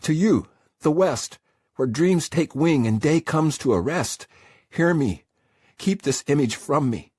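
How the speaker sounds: high, loud, pleading invocation; desperate prayer. Acted. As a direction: cry out to the west for help. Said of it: "I tried to pray".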